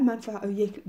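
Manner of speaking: usual speaking voice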